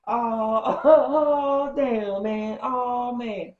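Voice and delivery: cracking up mid-sentence; laughing. Retold or imitated. Donkey laughing at the broken car, loud laughing. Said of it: "Donkey starts laughing".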